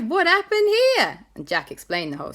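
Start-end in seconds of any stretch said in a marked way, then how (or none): 0.0-1.2 high, loud startled ask; surprised coastguard. Acted. Drill arriving at the crash, loud and surprised. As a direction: burst in surprised, slow and loud.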